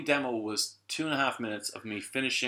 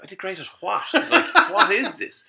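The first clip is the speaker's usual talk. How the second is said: high, loud, disbelieving; baffled outburst; 10 semitones higher, 12 dB louder than usual. Retold or imitated. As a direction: laugh through sheer confusion. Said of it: "And I was like".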